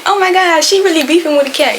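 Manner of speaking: high-pitched imitation of gossipy kids; lightly mocking, amused squeal; giggling kids' teasing. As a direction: mimic kids tickled by the drama. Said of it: "they laugh. They key, key, keying".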